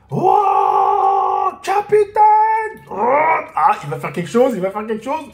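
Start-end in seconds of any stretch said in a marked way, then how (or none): 0.1-3.8 high, loud, stretched shout; anguished shout. Real, not acted. Fan crying out as the captain falls, loud high shout. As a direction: call out to the captain in alarm.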